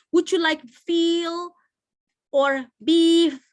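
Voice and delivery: slow, high 'feel' and loud high 'beef'; stretched question held high on 'feel' and 'beef'; upward, unfinished intonation. Shown as a demonstration. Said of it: "kalau kamu punya intonasi ke atas".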